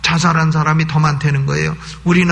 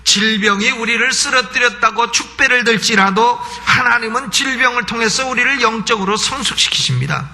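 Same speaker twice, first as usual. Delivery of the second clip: high, insistent pulpit voice; forceful preaching; 6 semitones higher than usual. Acted. Preacher urging the congregation not to fear the devil, forceful preaching. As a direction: drive each clause up to a blessing.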